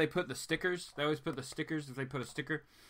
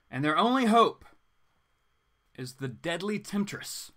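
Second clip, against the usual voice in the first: booming trailer-narrator voice, louder; dramatic blurb read; 4 semitones higher, 4 dB louder than usual. Acted. Comic vlogger reads the back cover as a dramatic trailer.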